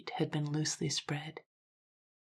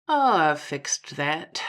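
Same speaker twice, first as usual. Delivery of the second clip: slow, unhurried and offhand; leisurely; 8 dB louder, much slower than usual. A narrator's reading. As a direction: let it roll out lazily, no concern. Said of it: "replied Dennison leisurely".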